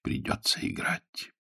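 low, soft resigned sigh. A narrator's reading. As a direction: breathe it out as if giving in. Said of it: "вздохнул гость".

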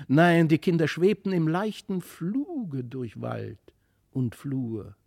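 plain reading voice

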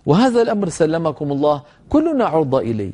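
ordinary delivery

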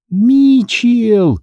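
high, stretched-out cry of a name; calling out. A narrator's reading. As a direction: call across a distance, high and long. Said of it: "закричала Мариса".

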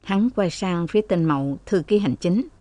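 ordinary delivery

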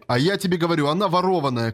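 ordinary delivery

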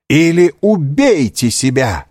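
громкий высокий медленный приказ; прикажи громко, с расстановкой; властный возглас. A narrator's reading. Девушка приказывает беглецу покончить с собой. (властно, с нажимом)

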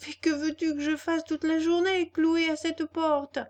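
complaining puppet. Acted. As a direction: protest in a high wooden-boy voice.

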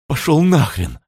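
high, bitter curse; hateful. A narrator's reading. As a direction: spit it out with hatred. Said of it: "с ненавистью сказала та".

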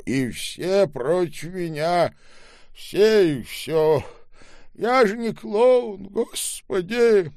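put-on old man complaining the world is against him, wailing; self-pitying old man. A narrator's reading. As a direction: lament slowly as the aggrieved old man.

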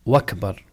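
وأكبر run together as wakbar; hamza turned waw. Shown as a demonstration. Teacher repeats the invalid word, wakbar. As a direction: say وأكبر in two syllables, "wak-bar". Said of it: "هذا أيضًا لا يصح".

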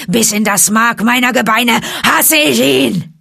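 hate-filled shout, high and loud; roaring. A narrator's reading. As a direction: bellow your hatred to the full. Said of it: "Da brüllte Shere Khan". (hasserfüllt gebrüllt)

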